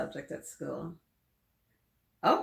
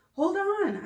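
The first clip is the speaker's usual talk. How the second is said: high, loud call from another room; little brother hollering from the living room; 7 dB louder than usual. Acted. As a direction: shout it across the house, high and loud. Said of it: "Caleb hollers".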